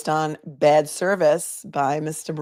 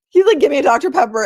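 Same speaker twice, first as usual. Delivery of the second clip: louder, higher, eager quote; excited retelling; 8 semitones higher, 8 dB louder, faster than usual. Retold or imitated. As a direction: replay it thrilled, a bit higher.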